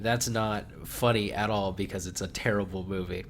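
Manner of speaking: ordinary talk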